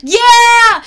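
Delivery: loud high cry of yes; a loud, high whoop; excited yell. Real, not acted. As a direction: belt it out with glee. Excited agreement.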